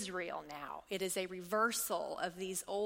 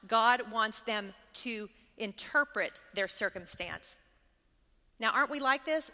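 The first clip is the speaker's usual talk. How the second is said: normal talking voice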